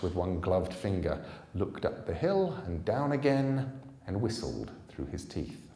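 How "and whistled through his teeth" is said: ordinary delivery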